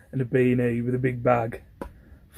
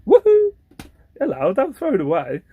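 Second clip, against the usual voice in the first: high squealing plea not to throw it; excited whoop; excited high call; 12 semitones higher, 4 dB louder than usual. Retold or imitated. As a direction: call out in high-pitched glee. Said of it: "I was like".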